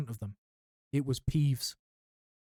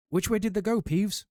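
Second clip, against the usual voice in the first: higher demanding caretaker voice; gruff caretaker; 8 semitones higher, 3 dB louder than usual. A narrator's reading. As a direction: demand an answer impatiently. Filch questioning Peeves.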